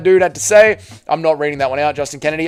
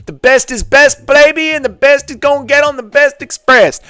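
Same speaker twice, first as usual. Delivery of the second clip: Max Holloway impression; 9 semitones higher, 4 dB louder than usual. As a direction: hype it up like a fighter promo. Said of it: "you listen to old Max Holloway interviews".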